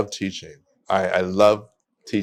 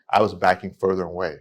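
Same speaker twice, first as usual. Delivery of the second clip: normal talking voice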